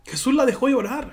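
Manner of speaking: ordinary talk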